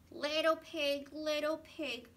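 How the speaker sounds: loud, gruff big bad wolf. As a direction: call out like the wolf at the door. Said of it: "said the wolf".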